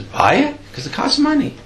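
high-pitched, astonished question and answer; incredulous. Acted. Rabbi answering the suggestion to buy a book, incredulous. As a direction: balk at the idea, pitched right up.

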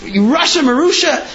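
a rabbi bursting out in fury, voice shooting up; furious rabbi. Retold or imitated. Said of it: "He was furious".

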